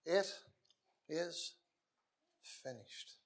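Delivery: whispered, dying away on finished; hushed cry. Retold or imitated. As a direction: breathe the last word out. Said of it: "he made that cry with all the authority".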